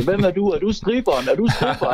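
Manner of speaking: hammed-up office joker heckling; drunk heckler.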